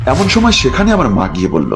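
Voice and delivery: ordinary delivery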